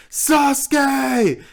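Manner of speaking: screamed anime battle cry; anime shout. Acted. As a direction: yell the name at full force. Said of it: "talking into a mic and being like".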